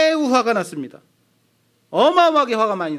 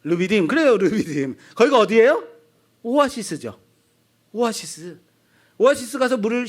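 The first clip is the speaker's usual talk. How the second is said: ordinary talk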